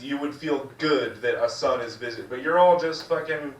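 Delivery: usual speaking voice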